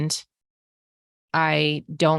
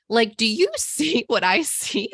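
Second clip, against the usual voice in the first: laughing in disbelief mid-question; a slower, higher incredulous laughing question; 7 semitones higher than usual. Retold or imitated. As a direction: laugh at how absurd it is while asking. Showing salesman the damaged car.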